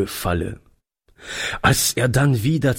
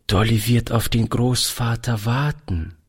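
ordinary delivery, nothing marked

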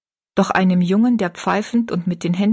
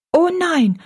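higher, quick firm refusal; firm young girl; 10 semitones higher than usual. A narrator's reading. Rosalie refusing food from the kitchen, higher and firm. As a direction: refuse kindly but decidedly, as a girl.

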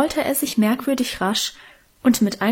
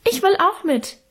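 put-on much higher small boy's piping; high piping little-boy plea; 9 semitones higher than usual. A narrator's reading. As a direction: pipe it like a tiny boy. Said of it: "piepste unser kleiner Hermann".

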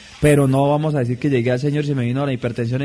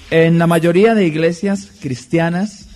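ordinary delivery

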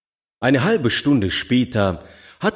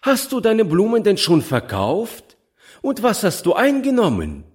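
higher, curious motherly questions; eager mother; 12 semitones higher, 4 dB louder than usual. A narrator's reading. Foster mother quizzes the child on her takings, higher. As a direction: ask the child eagerly about her sales.

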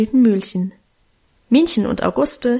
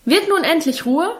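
raised, higher stern scolding; scolding father; 7 semitones higher than usual. A narrator's reading. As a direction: snap at the noisy children. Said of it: "schalt Vater in erhöhter Lautstärke".

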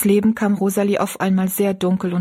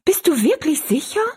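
put-on high, anxious girl's question; dismayed question; 9 semitones higher than usual. Rosalie anxiously asks Betty Ann whether it is certain. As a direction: plead for it not to be true. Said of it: "rief Rosalie bestürzt".

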